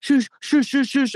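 high, rapid-fire mock shushes; imitated shushing. Chaperone silencing the boy before running out, mock shushing. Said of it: "And he goes".

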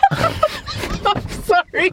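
high, drawn-out apology through laughter; real giggling fit; helpless laughter. Real, not acted. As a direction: squeeze the words out while cracking up. Helpless laughing fit interrupts recording.

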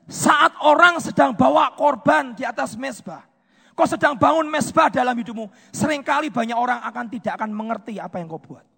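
loud, emphatic pulpit declaration; full-force preaching. Real, not acted. (khotbah lantang penuh penekanan)

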